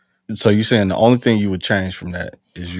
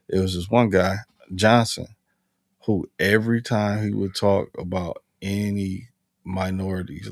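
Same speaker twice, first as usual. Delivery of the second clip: ordinary delivery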